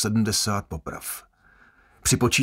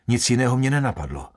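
ordinary delivery, nothing marked

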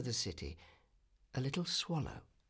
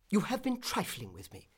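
mild cry, higher pitch; a touch of wounded indignation; indignant cry; 7 semitones higher than usual. A narrator's reading. Swallow to the reed who refused him, mildly indignant cry. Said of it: "he cried".

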